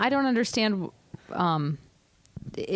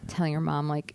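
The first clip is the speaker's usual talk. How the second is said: normal talking voice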